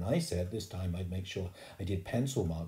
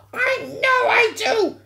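slow shriek, very high and loud; furious schoolgirl; 13 dB louder, much slower than usual. A narrator's reading. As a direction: scream it back, stretching every word. Sheila angry, insisting.

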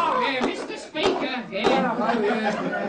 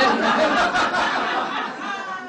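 breathy laugh; 8 semitones higher than usual. Real, not acted. Laugh mid-joke.

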